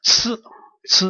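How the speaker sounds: "ts" as one hissed consonant; isolated "ts", repeated; demonstrated sound. Shown as a demonstration. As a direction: say ts like the end of hats. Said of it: "that one is read as ts".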